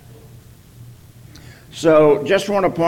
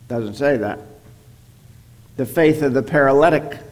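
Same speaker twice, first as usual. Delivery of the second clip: usual speaking voice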